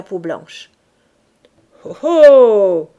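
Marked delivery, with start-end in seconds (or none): 1.8-2.9 loud, drawn-out oh of amazement; wonderstruck exclamation. A narrator's reading. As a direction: marvel aloud like an awed boy. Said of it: "émerveillé". (exclamation émerveillée)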